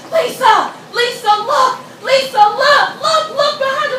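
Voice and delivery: high, loud retold yell of a coach; imitating coach screaming. Coach screaming to swimmer to look behind, high yelled call. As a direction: call out her name like a frantic coach. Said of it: "she's screaming".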